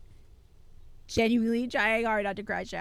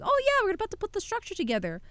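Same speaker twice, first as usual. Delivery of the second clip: mock cheerful; 11 semitones higher, 5 dB louder, much faster than usual. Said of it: "As I'm like".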